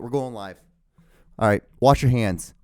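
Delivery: usual speaking voice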